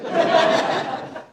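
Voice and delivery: airy unvoiced laugh; breathy laugh. Real, not acted. Preacher laughing at his homework blunder. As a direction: laugh at yourself.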